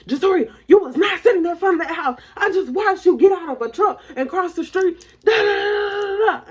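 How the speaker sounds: high, loud accusation from an angry mom; shrieked-up scolding, insistent; mama yelling. Retold or imitated. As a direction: imitate a mother contradicting her kid at full pitch. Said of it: "she's down hard yelling at me".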